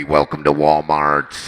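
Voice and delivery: very low, loud, slow robotic drone; voice box greeting. Retold or imitated. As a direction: buzz it through an electrolarynx. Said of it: "a guy with a voice box".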